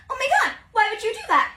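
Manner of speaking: high, loud, fast shocked cry; girl to her crush after the kiss, a loud shocked outcry. Retold or imitated. Girl recoils from unasked kiss.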